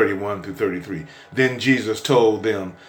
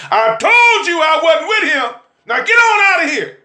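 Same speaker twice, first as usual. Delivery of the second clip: high, loud preaching climb; whooping sermon cadence; preaching at full force; 13 semitones higher, 4 dB louder than usual. Acted. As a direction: preach it hard to the congregation. Preaches the Peter story.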